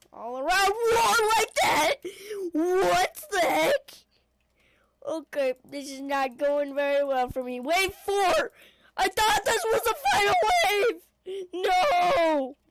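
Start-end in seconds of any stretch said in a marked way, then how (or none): 0.4-3.9 high, loud disbelief; loud incredulous questions; startled outcry. Real, not acted. Zombies trap player suddenly. 7.6-12.6 high, loud wail of disbelief; anguished gamer outburst; dismayed shouting. Real, not acted. Dismayed by another wave.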